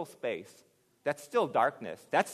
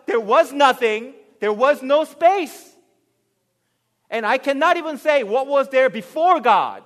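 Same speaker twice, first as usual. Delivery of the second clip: high, loud, driving sermon voice; shouted pulpit conviction; fervent preaching climax; 9 semitones higher, 14 dB louder than usual. Acted. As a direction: hammer the point home from the pulpit.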